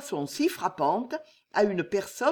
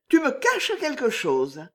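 suspicious lady; 7 semitones higher, 3 dB louder than usual. Acted. Jeanne, frightened mistress.

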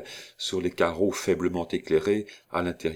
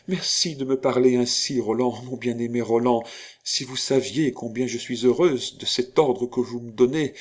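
higher-pitched, adoring outpouring; fervent gratitude; 5 semitones higher, 4 dB louder than usual. Acted. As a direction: thank your beloved rapturously. Grateful declaration to beloved. (reconnaissance exaltée)

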